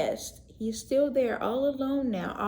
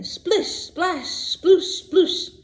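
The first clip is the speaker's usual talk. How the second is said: high, loud splish splash, laughing; playful splashing sounds; gleeful splashes; 9 semitones higher, 6 dB louder, much slower than usual. A narrator's reading. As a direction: splash in with glee. Splashing into water.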